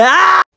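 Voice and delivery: loud, very high yelled note; high scream. Acted. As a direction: cap the song with a shriek. Scream ends the sung line.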